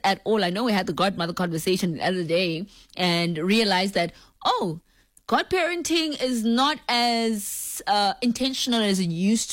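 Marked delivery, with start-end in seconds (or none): none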